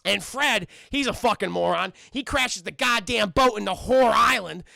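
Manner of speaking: usual speaking voice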